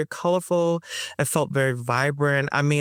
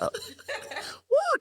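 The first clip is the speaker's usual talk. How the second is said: excited whoop; 5 dB quieter than usual. Real, not acted. Joyful reaction to old memory.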